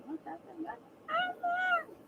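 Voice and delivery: very high, slow, drawn-out whine of the question; desperate whine. Real, not acted. Begging chat again, very high drawn-out whine. Whining for answers.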